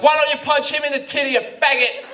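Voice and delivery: put-on high, jeering girlfriend shout; mock yelling. Girlfriend taunting him during police questioning, mock yelling. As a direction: heckle him loudly as the girlfriend, hammed up. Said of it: "yelling shit at me".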